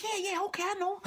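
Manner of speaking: high, soft humoring coo; soothing wife. Acted. Wife humors her husband, high and soft. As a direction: pat him on the head with a high soft voice.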